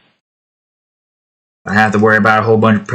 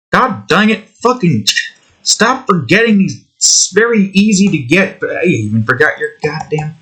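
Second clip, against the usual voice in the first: exasperated; 7 semitones higher than usual. Real, not acted. Artist who forgot the character's eye, exasperated. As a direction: scold yourself in rising frustration, then drop off. Frustrated at forgetting the drawing's eye.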